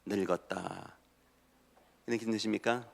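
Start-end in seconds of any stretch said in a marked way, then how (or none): 0.0-1.0 very soft, low mutter; dejected self-talk. Acted. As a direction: sigh it to your reflection in the mirror. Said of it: "어떤 느낌이 납니까?". (낮고 힘없이 한탄하듯)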